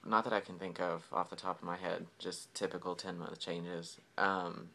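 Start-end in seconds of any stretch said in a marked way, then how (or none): none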